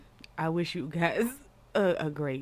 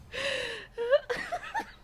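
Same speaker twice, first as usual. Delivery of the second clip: laughing hard. Real, not acted. Host reacting to clip.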